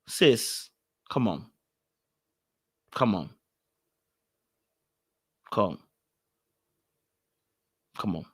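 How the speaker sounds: very low, soft appeal; hushed, let-down reproach; weary disappointment. Acted. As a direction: drop it low and quiet, with long gaps. Weary pleading after the scolding.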